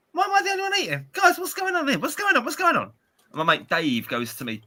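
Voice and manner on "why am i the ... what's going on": high, baffled retold questions; baffled squeak. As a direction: replay your own confusion in a much higher voice. Retold confusion in kebab shop.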